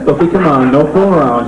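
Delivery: normal talking voice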